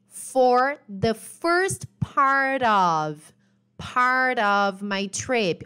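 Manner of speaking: very slow, each word laid out for students; teacher slowly models the phrase for the class, stretched out; slow model reading. Shown as a demonstration. Spell it out slowly like a teacher modelling. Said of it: "Olhem o linking sound".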